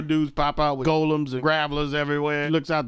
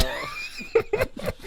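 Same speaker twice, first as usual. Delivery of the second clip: high squeal laugh; 7 dB quieter than usual. Real, not acted. Loud laugh.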